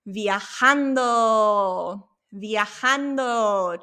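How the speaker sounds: "viajando" stretched out very slowly; slow model word. Shown as a demonstration. Teacher slowing down a favorite word for students. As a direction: draw out "viajando" syllable by syllable.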